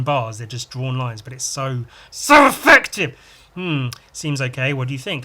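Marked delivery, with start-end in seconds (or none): 2.1-3.2 high, loud burst of admiration; loud, punched-out praise; emphatic enthusiasm. Real, not acted. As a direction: gush it with sudden force. Enthusing over the drawing.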